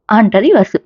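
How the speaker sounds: usual speaking voice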